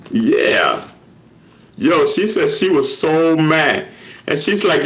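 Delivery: ordinary talk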